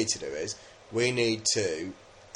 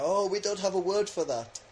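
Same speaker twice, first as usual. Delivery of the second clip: higher-pitched put-on reply; imitating puzzled listeners; 9 semitones higher than usual. Mimicking locals' reply whenever he says Beckham, high imitating voice. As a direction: lift the voice high to mimic their reaction. Voicing people's reaction to Beckham.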